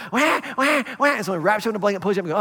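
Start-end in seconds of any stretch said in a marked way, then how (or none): 0.1-1.1 high mock newborn wail; imitating a baby's wail in a sermon; baby wail imitation. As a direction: cry like a newborn baby, playfully.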